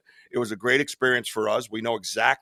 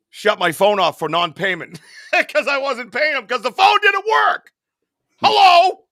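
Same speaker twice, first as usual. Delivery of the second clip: laughing, rising to a high, loud outraged "Hello?"; incredulous rant; 11 semitones higher, 7 dB louder than usual. Real, not acted. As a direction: build from a laugh into outraged disbelief. Ranting about Verizon shutoff.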